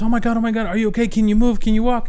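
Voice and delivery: high, anxious questions; worried mom. Retold or imitated. Mom checking if her child can walk, worried and high. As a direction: fret over the child.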